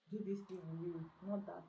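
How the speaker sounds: normal talking voice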